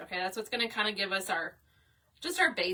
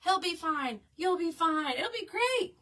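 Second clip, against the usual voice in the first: high, loud, upbeat mock assurance; breezy crowd reassurance; 8 semitones higher, 3 dB louder than usual. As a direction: imitate strangers cheerily waving off a worry. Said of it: "everyone's like".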